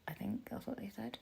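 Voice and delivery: very soft, whispered afterthought; unsure whispered aside about what the doctors said. Real, not acted. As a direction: drop to a whisper, hedging. Unsure aside about medical advice.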